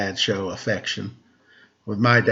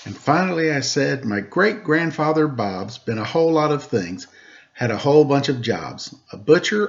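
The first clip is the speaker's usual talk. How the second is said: lively rhymed storybook reading; reading a story to children; 3 semitones higher than usual. A narrator's reading. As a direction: read the rhyming story aloud to the kids. Reads a rhymed picture book aloud.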